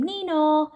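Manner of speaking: sung siren nee-naw. Acted. Police arriving at the farm, a mock siren.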